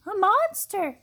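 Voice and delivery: high loud scared cry; frightened shout. A narrator's reading. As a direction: shriek at the monster. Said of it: "shouted Baby".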